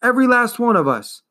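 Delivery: put-on boy screaming at the turtle in despair. Continues the scream.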